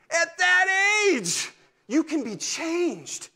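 high, loud and very slow; slow emphatic. Real, not acted. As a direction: stretch every word out to land the point. Preacher's emphatic point.

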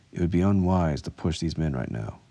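usual speaking voice

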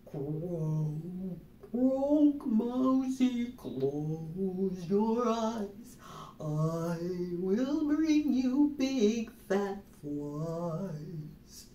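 slow sung frog lullaby, croaks low; croaky frog lullaby. Acted. Missus Frog sings the baby mouse to sleep, slow croaky lullaby. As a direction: croon it like a mother frog. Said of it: "sang a lullaby that went like this".

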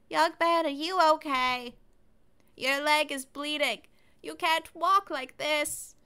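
put-on high, slow old lady voice; a caring granny, slowed right down; generic old person voice. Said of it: "my generic old person voice".

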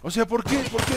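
normal talking voice

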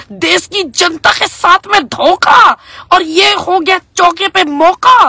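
slow, loud, high indignant cry; hammed-up outraged shout, drawn out; mock outrage. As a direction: declaim the betrayal like a wronged crowd. Outraged at hidden truth.

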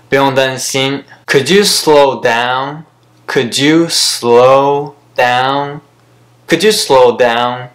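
ordinary talk

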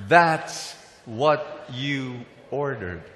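slow, word-by-word call for repetition; leading a repeat. Shown as a demonstration. Preacher has everybody say the waiter's line, slow and emphatic. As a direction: space out each word for the crowd. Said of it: "Can everybody say that?".

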